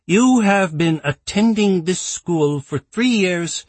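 high, strict scolding schoolmistress voice; stern headmistress. Acted. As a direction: look down on him and scold. Strict principal scolding student.

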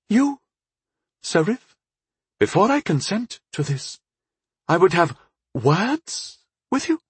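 high, measured lady's voice with pauses; irritated elven lady. Acted. Irritated bride-to-be addresses her suitor, high and deliberate. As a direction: set terms in a high, formal voice.

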